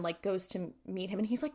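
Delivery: ordinary talk, nothing marked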